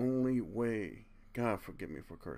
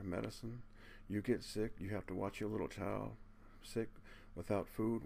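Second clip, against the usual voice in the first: ordinary talk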